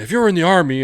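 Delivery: angry teacher. Retold or imitated. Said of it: "he was like".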